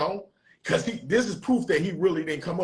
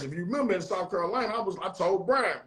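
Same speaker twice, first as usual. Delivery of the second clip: ordinary delivery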